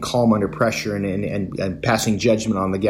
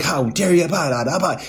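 higher, hammed-up indignant outburst; mock tantrum; 6 semitones higher than usual. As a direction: imitate a passenger's tantrum, half-mocking. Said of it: "puts up this big".

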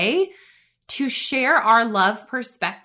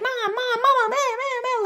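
much higher, imitating kids nagging "Mom, Mom, Mama"; kids calling for mom; 14 semitones higher than usual. Mom mimics her kids pestering her in the morning, high and whiny. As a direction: pitch up like whiny little kids calling again and again. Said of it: "my kids are like".

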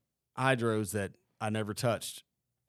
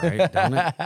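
laughing; 7 semitones higher, 8 dB louder than usual. Real, not acted. Laughing banter.

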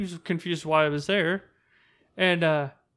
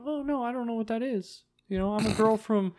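higher, put-on girly voice; 6 semitones higher, 3 dB quieter than usual. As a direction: play a clueless young woman who knows nothing. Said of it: "I like how your voice changed".

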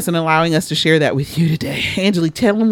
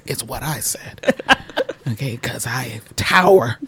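whisper; 7 semitones lower, 5 dB quieter than usual. Real, not acted. Whispered brag repeat.

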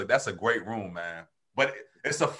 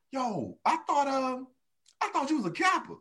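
a hint of a surprised buddy, higher and a bit softer; imitating a buddy; 10 semitones higher, 5 dB quieter than usual. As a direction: play the puzzled old teammate, pitched up.

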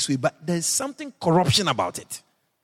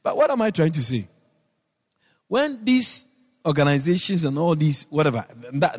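usual speaking voice

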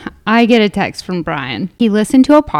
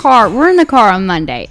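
ordinary talk, nothing marked

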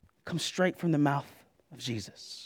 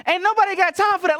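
much higher, louder, sassy meme voice; high viral-video impression; 7 dB louder than usual. Pastor impersonating the viral video clip, high and sassy. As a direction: belt it like the woman in the clip. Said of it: "Some of y'all know that video".

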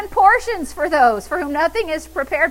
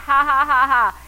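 loud, mocking ha-ha; laughing; 5 dB louder than usual. Shown as a demonstration. Believer telling the devil he is defeated, mocking laugh. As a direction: punch out a scornful laugh. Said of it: "A believer starts laughing".

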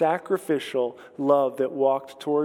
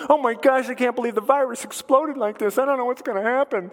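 mock panicked Jesus; 7 semitones higher than usual. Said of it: "He's not up in heaven going".

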